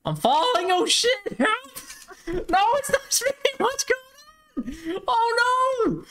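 very high panicked yelps; shrieking in fear while falling. Real, not acted. Falling out of the sky.

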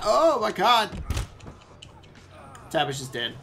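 ordinary talk

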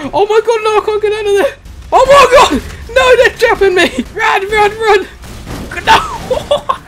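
high, loud panicked yells; panicked screaming. Real, not acted. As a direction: scream in panic, laughing through it. Panicked when trapped.

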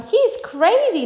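slow, high cry of alarm; high, drawn-out alarmed outburst; frightened shout. A narrator's reading. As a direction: shout it in a panic, pitched right up. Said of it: "in the chorus of frightened shouting".

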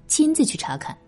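ordinary delivery

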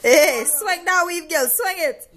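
high, loud cheering; hype. Real, not acted. Cheering on her sister while doing her hair.

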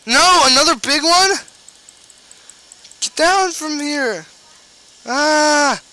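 high panicked shouts; being chased. Real, not acted. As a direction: yell in fright while fleeing. Fleeing big slimes.

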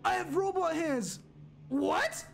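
very high-pitched, thrilled disbelief; amazed. Real, not acted. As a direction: squeal it out in astonishment. Discovers he is a robot.

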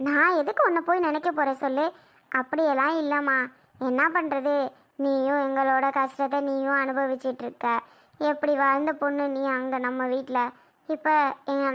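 ordinary talk, nothing marked

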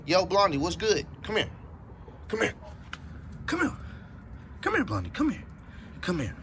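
high, soft coaxing call to a pet; calling the dog. Real, not acted. Owner calls Blondie over to show viewers, high coaxing call.

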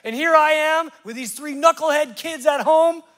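high, loud exasperated complaint; hammed-up wail of an overlooked mom; discouraged wife. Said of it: "and she felt bad".

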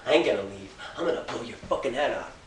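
ordinary talk, nothing marked

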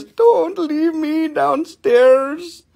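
high, drawn-out whine as the dog; whining dog. Acted. Dog crying at the door to come in, high whine. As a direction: slowly plead in a high put-on whine. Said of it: "The dog says".

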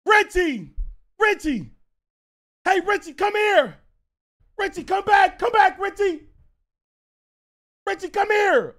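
high, loud, repeated calling; imitating a kid's insistent yell. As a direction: holler for someone across the room. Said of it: "I hear the kid yell".

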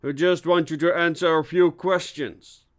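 high, insistent request; calling out. A narrator's reading. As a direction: call across an attic to someone hiding. Police calling to hidden suspect.

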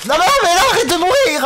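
high, loud mock scolding; exasperated yelling. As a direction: plead and scold in playful outrage. Scolds character who keeps dying.

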